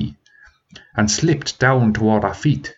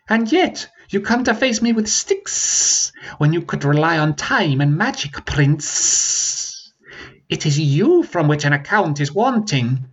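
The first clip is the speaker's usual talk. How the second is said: riled dragon taunting; 6 semitones higher than usual. Acted.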